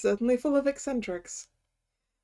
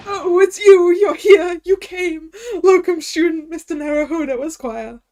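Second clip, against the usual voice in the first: much louder, higher, overjoyed cry; excited greeting; 4 semitones higher, 8 dB louder than usual. Acted. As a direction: burst out with relief at the visitors. Prisoner greeting visitors.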